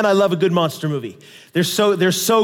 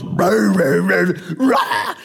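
monster roar; 3 semitones lower, much slower than usual. Retold or imitated. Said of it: "he goes".

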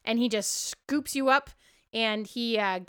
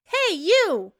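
Darcy confronting; 7 semitones higher than usual. Acted. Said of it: "Darcy's like".

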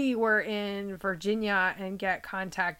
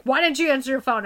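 louder, fast, accusing question; demanding; 4 semitones higher, 6 dB louder, faster than usual. Retold or imitated. Mom demands why she ignored calls, louder and quick. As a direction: snap it out like an annoyed mom. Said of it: "and she's like".

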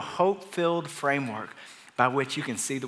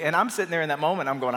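usual speaking voice

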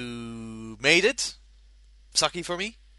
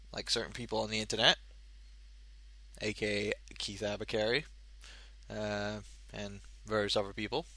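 muttered aside; 5 semitones lower, 11 dB quieter, faster than usual. Real, not acted.

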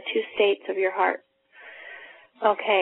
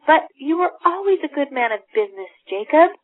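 raised, higher voice rising to a loud name; Scrooge pleading; 6 semitones higher, 5 dB louder than usual. Acted. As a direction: protest to his old partner, higher. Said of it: "And Scrooge says".

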